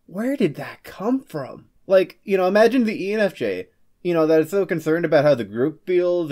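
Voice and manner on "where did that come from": slow, bewildered question; baffled by yourself; puzzled surprise. Retold or imitated. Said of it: "you're like".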